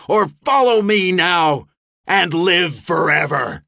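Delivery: rally them to follow you into battle. A narrator's reading. Gabriel facing the encroaching horde, shouted rally. Battle speech with sword drawn.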